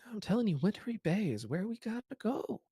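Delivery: high, eager little halfling; excited girl voice. Acted. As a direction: pitch it way up, gushing. GM voicing Bonnie mid-conversation.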